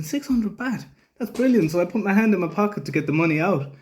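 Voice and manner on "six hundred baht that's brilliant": much higher, delighted inner voice; light up at how cheap it is; relieved surprise. Retold or imitated. Biker relieved at the small fine, high delighted thought. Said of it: "and I was thinking".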